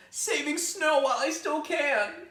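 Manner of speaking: sulky bunny holding on to winter; grumbling rabbit. Acted. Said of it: "Rabbit grumbled".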